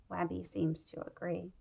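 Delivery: ordinary delivery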